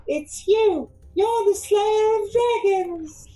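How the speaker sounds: high, squeaky kitten voice, slowing on the second line; a tiny awestruck kitten gushing; excited kitten. Acted. Tabaxi kitten gushing at the famous dragon slayer, high and squeaky.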